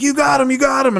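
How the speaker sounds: high-pitched eager buddy; imitated excitement. Buddy running over after the shot, high and excited. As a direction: excitedly report it as you run up.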